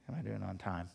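ordinary delivery

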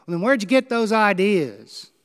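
loud, high challenging yell; retold heckler demanding an answer; heckler's shout. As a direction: bark the question back at her. Heckler presses the candidate.

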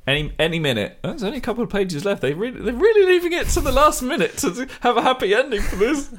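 a naive reader clinging to hope; naive hopeful reader. Retold or imitated. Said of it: "being like".

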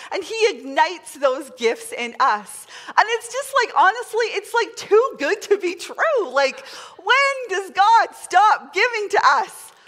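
usual speaking voice

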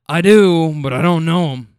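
put-on a loud, chuckling old-timer boast; lightly voiced old guy, pleased with himself. Driver imitating an old rider who brags he doesn't know his kids, in a lightly voiced old-timer voice.

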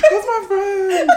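high, slow imitation of tearful friend; mock crying wail. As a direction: blubber it up high, laughing underneath. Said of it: "Franzi started crying".

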